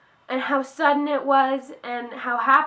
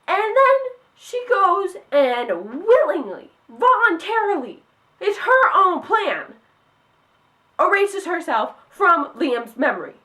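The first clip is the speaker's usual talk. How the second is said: real heated fan frustration; worked up; 6 semitones higher, 4 dB louder than usual. Real, not acted. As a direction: spell out the betrayal in outrage. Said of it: "I'm sorry if I'm getting a little worked up".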